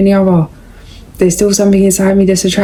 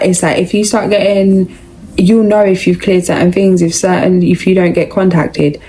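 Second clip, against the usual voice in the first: usual speaking voice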